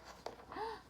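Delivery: quick high gasp of alarm; sharp gasp. Real, not acted. Reader watching the dog drop, gasp.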